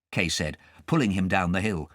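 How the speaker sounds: plain reading voice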